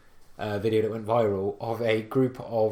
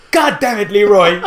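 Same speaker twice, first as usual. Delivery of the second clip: high, loud, fed-up shout at Leroy; exasperated teammate; 9 semitones higher, 14 dB louder than usual. Retold or imitated. Teammate blaming Leroy after the wipe, a loud exasperated shout. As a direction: yell it like the raid just wiped. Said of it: "you just hear one guy go".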